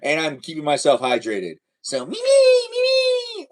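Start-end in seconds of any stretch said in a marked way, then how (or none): 2.0-3.5 very high, slow, wailing call of her name; mock Mimi call. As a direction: stretch the name out in a high teasing wail. Repeats his Mimi imitation.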